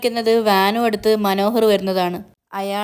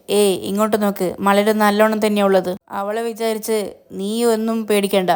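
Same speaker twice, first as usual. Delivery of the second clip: usual speaking voice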